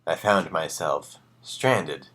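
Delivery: plain reading voice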